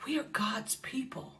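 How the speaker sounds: soft hushed whisper. Real, not acted. Preacher to the congregation, whispered. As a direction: breathe it like a secret truth.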